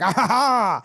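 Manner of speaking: high, hammed-up villain laugh; mad cackle. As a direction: cackle like a gleeful madman on a drone. Imagines Kubrick cackling on the drone.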